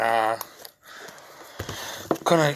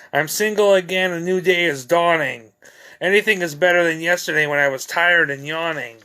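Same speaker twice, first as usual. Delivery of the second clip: higher-pitched, rhythmic verse reading; poem recitation; 4 semitones higher than usual. Acted. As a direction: read it out as verse, leaning on each repeated opening. Reads own poem aloud.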